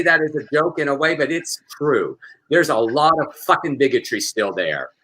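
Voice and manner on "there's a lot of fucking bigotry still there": usual speaking voice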